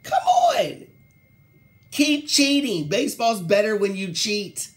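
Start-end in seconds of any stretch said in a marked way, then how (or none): 0.0-2.9 much higher, louder cheering shout; excited shout. Real, not acted. Host cheering baseball cheating to viewers, loud high shout. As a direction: egg them on like a fan in the stands. Ranting, egging on players.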